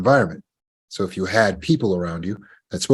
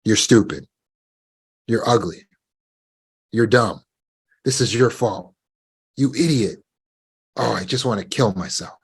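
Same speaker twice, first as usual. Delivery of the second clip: inner critic voice. Retold or imitated. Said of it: "their subconscious voice says things like".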